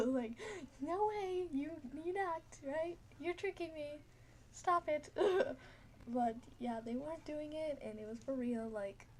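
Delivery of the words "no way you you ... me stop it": scared pleading. Retold or imitated. Begging friends to admit trick.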